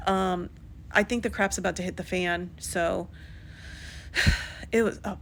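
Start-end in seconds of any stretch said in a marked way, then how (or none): 3.1-4.7 long tired breath out; weary sigh. Real, not acted. Recapping a dramatic episode to viewers, a weary sigh. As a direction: let out a heavy sigh.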